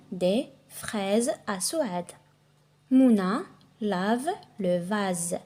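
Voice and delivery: slow, spaced-out reading, word group by word group; slow dictation reading. Shown as a demonstration. Teacher reading example sentences to French learners, slow dictation style. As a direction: read it slowly and distinctly for learners.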